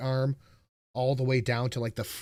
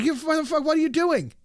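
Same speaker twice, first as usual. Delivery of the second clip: high outraged yelp at the doctor; mock pained outburst; faster than usual. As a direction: yelp it up high like the needle just hit. Retelling pain during needle.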